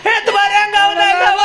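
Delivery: very high, drawn-out mourner's wail; mock wailing. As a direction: wail it high and slow, hammed up. Mourner wailing over the dead man. (ಎತ್ತರದ ಸ್ವರದಲ್ಲಿ ನಾಟಕೀಯ ಗೋಳಾಟ)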